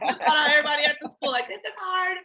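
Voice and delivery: retelling her own complaint, fast, high and laughing; laughing whine. Retold or imitated. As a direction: laugh at your past self melting down. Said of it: "'Cause I was like".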